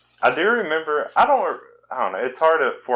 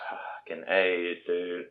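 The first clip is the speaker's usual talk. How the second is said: slow, softer, exaggerated Southern drawl; Southern accent; 3 semitones lower, 8 dB quieter, much slower than usual. Mocking her own Southern accent, slow exaggerated drawl. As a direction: drag it out like a lazy Southern drawl. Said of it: "I hate my country is Southern accent".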